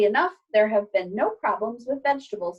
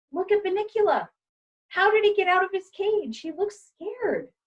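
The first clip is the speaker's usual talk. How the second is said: put-on high, anxious child's voice; young boy alarmed over the rabbit; little boy Toby; 10 semitones higher than usual. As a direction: voice the worried boy, pitched up.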